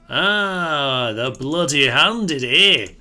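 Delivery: loud, hammed-up Scottish taunt; a jokey Scottish accent, loud and playful; weird Scottish accent. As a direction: call out the Bloody Handed in a mock Scottish brogue. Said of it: "some sort of weird Scottish accent".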